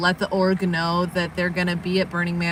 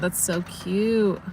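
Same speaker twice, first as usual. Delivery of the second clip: ordinary talk, nothing marked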